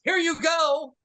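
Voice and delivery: high-pitched mock handover; acted-out high, cheeky call; retold breezy toss-off. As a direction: sing it out high as a quick send-off.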